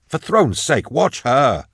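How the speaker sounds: high, impatient outburst; exasperated urging. Acted. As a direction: insist they look, voice jumping up. Endor exasperated, urging companion to watch.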